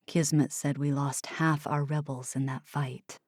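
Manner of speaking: hint of a low grieving man; lightly voiced Oliver. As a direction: deliver the loss quietly.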